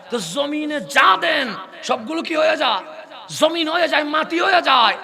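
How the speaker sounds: high, loud, drawn-out sermon cry; preaching at full force. Real, not acted. Preacher rousing the congregation at a mahfil, full-force sermon cry. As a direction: preach it out to the whole crowd.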